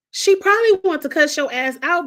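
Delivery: fired-up loud rant; heated outburst. Real, not acted. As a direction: defend her, worked up. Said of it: "heated rant".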